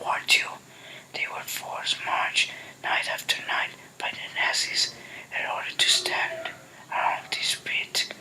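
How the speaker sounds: hushed, fast whisper. A narrator's reading. Father whispering the grave's history to his son. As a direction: whisper it, as if afraid to be overheard. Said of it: "Dad spoke quietly".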